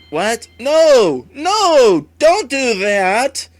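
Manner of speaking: loud, high, rising panic; real alarmed shout. Real, not acted. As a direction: yell at the screen to stop. Player reacts to the game.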